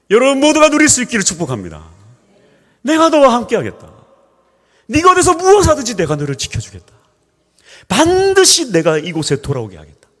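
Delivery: high, loud ringing proclamation; preaching at full force. Acted. Preacher blessing the congregation with God's promise, full-force preaching. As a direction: pronounce the blessing over the crowd.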